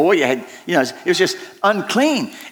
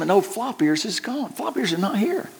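softer, letting the loss sink in; hushed dismay; 4 semitones higher, 6 dB quieter than usual. Retold or imitated. Shepherd recounting his flock, softer in quiet dismay. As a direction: drop the voice as the count comes up short.

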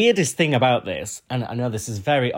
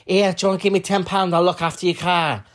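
put-on Scouse boy; 7 semitones higher, 3 dB louder than usual. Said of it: "he was like".

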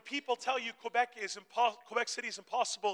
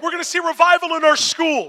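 high, very loud proclamation; fervent pulpit shout; full-force preaching; 8 semitones higher, 19 dB louder than usual. Real, not acted. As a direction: shout it out to the whole congregation.

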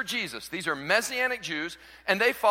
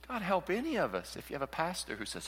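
ordinary talk, nothing marked

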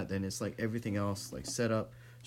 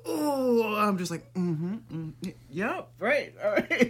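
awkward polite agreeing; 9 semitones higher, 4 dB louder, slower than usual. Retold or imitated. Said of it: "I'm like".

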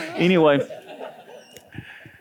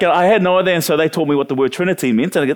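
ordinary talk

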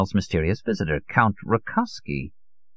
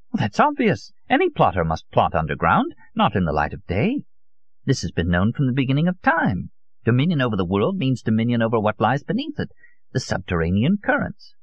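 put-on higher, insistent old man's voice; 5 semitones higher than usual. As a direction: expound a secret truth as if self-evident.

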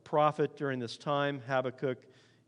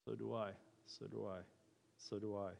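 much softer, lower voice; hushed admission; 4 semitones lower, 13 dB quieter than usual. Real, not acted. Pastor admits he lives through it too, hushed. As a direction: confess it quietly, almost to yourself.

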